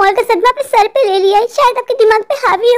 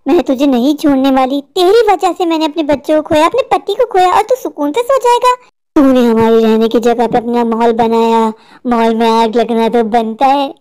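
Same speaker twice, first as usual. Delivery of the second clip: low-pitched menacing witch voice; deep monotone witch out for revenge; vengeful witch; 8 semitones lower than usual. Acted. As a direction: accuse him as a wronged witch, dropping very low.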